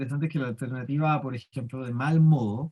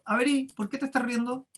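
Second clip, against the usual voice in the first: high, mock-sharp challenge; a touch of irritation, put on; rude retort; 7 semitones higher than usual. Teacher acting out a rude reply to the class, mildly snappy. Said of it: "De mala manera le digo".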